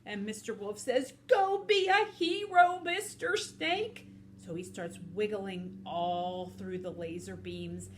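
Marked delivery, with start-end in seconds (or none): 1.3-4.1 high, loud, drawn-out call; wolf's hearty encouragement; rousing cheer. Acted. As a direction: cheer on a buddy like a hero. Wolf cheers the snake on.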